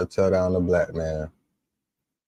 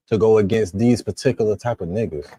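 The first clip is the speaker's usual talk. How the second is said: ordinary delivery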